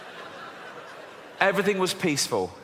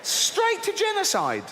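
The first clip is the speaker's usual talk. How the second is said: high-pitched incredulous rant; outraged disbelief; 12 semitones higher than usual. Real, not acted. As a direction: squeal your outrage at the overkill. Ranting at God's overreaction.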